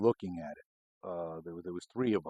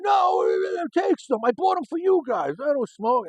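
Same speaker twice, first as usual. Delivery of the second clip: much higher, louder, shouting put-on voice of a pushy coworker; insistent Danny; 9 dB louder than usual. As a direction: shriek it at them, he won't take no.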